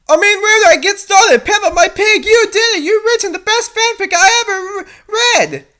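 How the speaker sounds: high, loud mock rave; gushing with heavy sarcasm; sarcastic gushing. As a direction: fake over-the-top praise. Mock praise of the fanfic.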